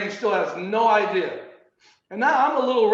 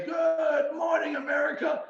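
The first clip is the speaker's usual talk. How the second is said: high, slow, shouted morning-show opener; a dream come true, shouting it to the nation; TV host greeting; 8 semitones higher, 4 dB quieter than usual. Pretend. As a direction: belt it out like a TV anchor. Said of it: "Been dying to say that".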